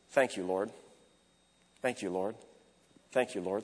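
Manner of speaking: soft, low, simple repeated thanks; quiet breath prayer. Shown as a demonstration. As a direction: murmur it gently on each breath. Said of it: "maybe just the simple one".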